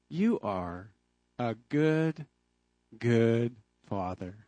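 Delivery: soft, low, slow words of thanks; hushed, grateful prayer to God; reverent thought. Retold or imitated. Thinking after catching the ball.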